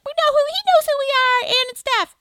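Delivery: gushing fan bragging. Retold or imitated. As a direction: brag about being known like a thrilled fan. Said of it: "how he's like".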